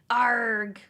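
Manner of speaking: loud pirate growl. Pretend. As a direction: bark it like a pirate. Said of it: "talking like a pirate on today's Talk Like a Pirate Day".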